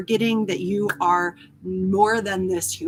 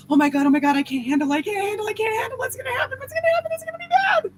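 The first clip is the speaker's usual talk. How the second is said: climbing higher and higher in anxious panic; mock panic; 10 semitones higher, much faster than usual. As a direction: spiral into a hammed-up freak-out.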